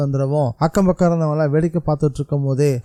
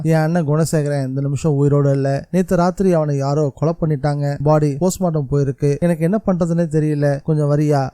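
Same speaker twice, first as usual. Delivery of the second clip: plain reading voice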